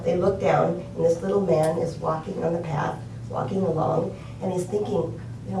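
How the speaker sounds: ordinary talk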